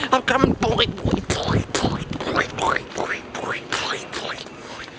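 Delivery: put-on "boy, boy" trailing from a call down to a whisper; fading call. Cowboy calls his dog, fading to a whisper. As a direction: keep calling the dog while the voice dies away to a whisper.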